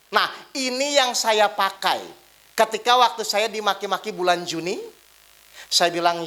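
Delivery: ordinary delivery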